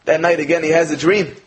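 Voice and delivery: normal talking voice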